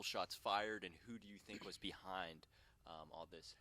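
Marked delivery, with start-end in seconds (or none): none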